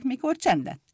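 usual speaking voice

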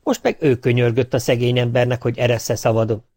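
usual speaking voice

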